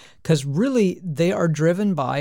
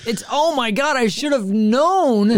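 high, slow oh my God; embarrassed realization; 10 semitones higher, 4 dB louder, slower than usual. Real, not acted. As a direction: caught out, drawn out. Realizing own mistake.

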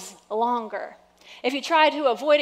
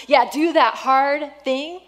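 loud, slow push on that hard thing; louder, slowed exhortation; emphatic preaching; 3 semitones higher, 7 dB louder, slower than usual. Real, not acted. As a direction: press the hard thing home. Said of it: "preaching emphasis".

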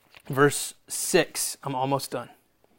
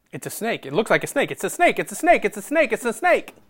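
quick panicky repeats, climbing in pitch; mock alarm; 4 semitones higher, faster than usual. Preacher acting out fear of the snake, mounting mock panic. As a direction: build the alarm with each repeat.

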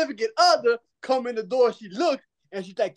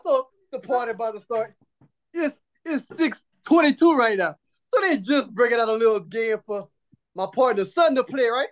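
ordinary talk, nothing marked

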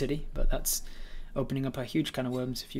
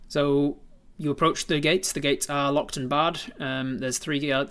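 ordinary delivery

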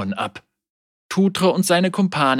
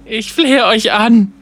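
slow, high, loud begging; desperate pleading; 9 semitones higher, 7 dB louder than usual. Acted. Captain imploring his dead crew, desperate pleading. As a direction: beg at the top of your voice. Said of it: "flehte er".

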